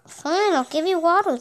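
ordinary talk, nothing marked

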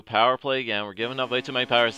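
ordinary talk

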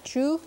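"chu" with a plain ch, no puff of air; "chu" unaspirated. Shown as a demonstration. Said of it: "versus a breathier chu".

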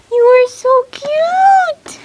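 high, slow cooing. Real, not acted. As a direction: coo sweetly at a tiny pony. Cooing at a foal.

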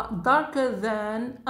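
slow teacher demo; slow model reading. Shown as a demonstration. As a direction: lay out each syllable for the class. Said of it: "فدارك".

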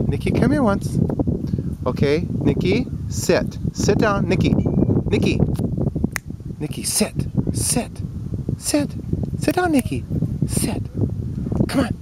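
high coaxing commands to sit; dog commands. Real, not acted. Man trying to make Nikki sit, high coaxing commands.

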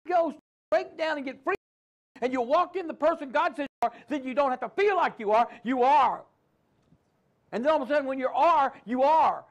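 loud, high, rising preacher cry; preaching at full force. Acted. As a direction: drive it home to the congregation.

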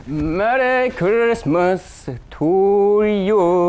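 slow, booming holiday proclamation; drawn-out grand greeting. Acted. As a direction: stretch every word grandly. Dramatic gift presentation.

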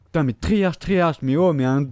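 raging gamer tirade; furious cursing. Real, not acted. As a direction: yell insults at the enemy. Cursing an enemy's movement.

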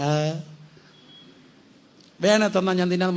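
lightly voiced whiny kid; whining child. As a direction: imitate a fussy schoolchild waiting to be fed. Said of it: "ചിണുങ്ങി ചിണുങ്ങി".

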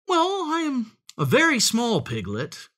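high, innocent piglet voice; wheedling piglet. A narrator's reading. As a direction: plead smallness in a high voice.

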